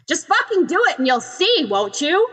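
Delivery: smug and sure of himself; cocky. Acted. Bakugo on the ledge, cocky taunt down to Ochako. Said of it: "utterly cocky".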